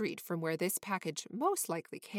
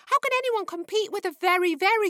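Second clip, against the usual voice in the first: much higher, louder, frantic cat voice; panicked cat Fluffy; 10 semitones higher, 7 dB louder than usual. A narrator's reading. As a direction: squeal in alarm like a jealous cat. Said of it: "thought Fluffy".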